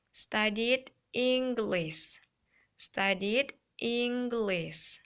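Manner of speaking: teacher drilling a phrase, slow and loud; slow, loud model reading. Shown as a demonstration. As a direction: project it slowly so every child hears. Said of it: "And then next".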